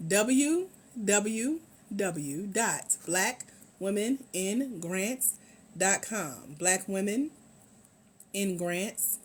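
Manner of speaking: slow, deliberate website dictation. Real, not acted. Host giving viewers the site address, slowly. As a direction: spell it out slowly, pausing between parts. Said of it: "I'm trying to say it slow".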